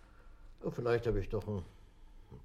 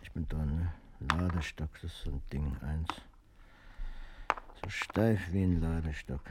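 usual speaking voice

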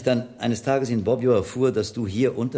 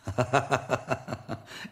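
short amused laugh; amused chuckle; 4 semitones higher than usual. Real, not acted. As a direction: chuckle at the coming fight. [name] laughs at the rivals. (amüsiertes Lachen)

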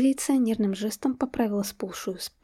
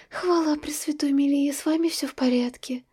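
relieved sigh; 5 semitones higher than usual. A narrator's reading. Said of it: "С облегчением выдохнула".

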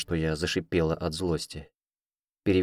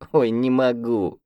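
breathless from laughing; 5 semitones higher, 4 dB louder, slower than usual. A narrator's reading. Heroine still laughing.

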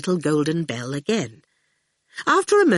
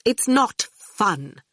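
slow, higher, icy correction; coldly; 5 semitones higher, much slower than usual. A narrator's reading. Dillion rebuffs Miss Potter's talk of large families, coldly. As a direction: space the words out coldly. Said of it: "Dillion said coldly".

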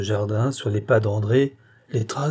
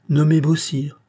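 "Nommé Beausire" higher and louder; emphatic repeat; 5 semitones higher, 5 dB louder than usual. A narrator's reading. Apothecary repeating the name to startled Maillard, emphatic. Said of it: "répéta l'apothicaire".

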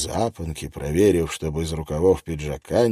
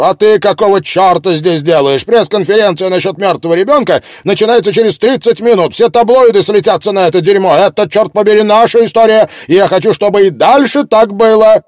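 furious editor; 12 semitones higher, 11 dB louder than usual. Acted. Angry editor scolding Miller.

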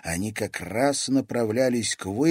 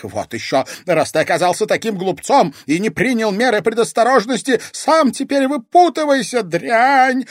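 high, loud, vicious refusal; building to a furious, high-pitched cry; spiteful shout; 14 semitones higher, 10 dB louder than usual. A narrator's reading. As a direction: shout it with open malice. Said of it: "злобно выкрикнул барон".

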